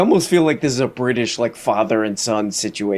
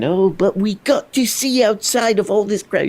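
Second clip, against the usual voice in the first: higher, airy mock guru; mock spiritual rant; 7 semitones higher than usual. Reactor imitating Russell's spiritual talk. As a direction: lampoon a preachy guru.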